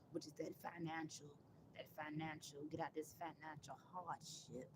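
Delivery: longing hush. Real, not acted. Wistful wish for money.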